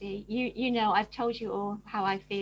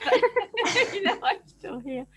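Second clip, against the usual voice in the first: laughing; 9 semitones higher, 8 dB louder, slower than usual. Real, not acted. Guest joking about her advanced age, laughing. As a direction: laugh breaking through the words, higher and louder.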